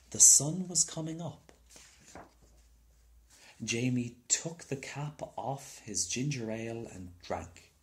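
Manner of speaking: usual speaking voice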